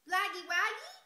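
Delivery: high, loud babbled question; baby voice. Acted. Baby on the plane asking for the bunny, loud babbling. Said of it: "asked the baby".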